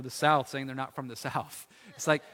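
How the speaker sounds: ordinary talk, nothing marked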